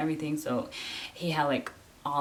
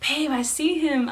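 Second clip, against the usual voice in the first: high, loud excited exclamation; pitched-up, thrilled retelling; excited husband; 10 semitones higher, 7 dB louder than usual. Retold or imitated. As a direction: call out the exciting news. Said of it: "I just got so excited".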